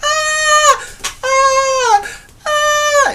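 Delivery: high, loud falsetto fangirl screams; mock screams of girls freaking out; imitated freak-out scream. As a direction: shriek like a starstruck fan. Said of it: "they're freaking out like".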